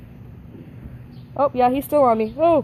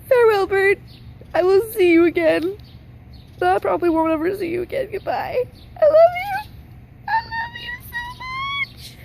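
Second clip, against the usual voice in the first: very high, loud, hammed-up weepy goodbye; theatrical crying goodbye climbing higher and higher; mock tearful farewell; 11 semitones higher, 4 dB louder than usual. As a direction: wail it like a melodramatic farewell. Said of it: "I'm gonna cry".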